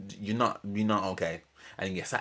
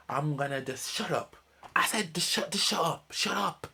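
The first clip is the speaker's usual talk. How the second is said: higher, hammed-up snappy shut up; mock outburst; 8 semitones higher than usual. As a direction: parody her snapping at everyone. Mocking Polly's snappy outburst.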